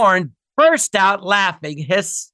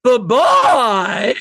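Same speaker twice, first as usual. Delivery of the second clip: big drawn-out catchphrase goodbye, higher and louder; catchphrase character; 5 semitones higher, 5 dB louder than usual. Acted. Comedian performing his catchphrase on request, big sketch-character voice. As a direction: belt out the farewell, stretching it.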